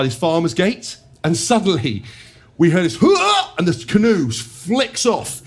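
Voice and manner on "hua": a short high yelp of a shout; mock yell "hua". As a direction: yelp it out suddenly, high and sharp. Teammate's yell as canoe flicks.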